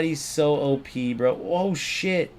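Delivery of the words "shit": ordinary talk, nothing marked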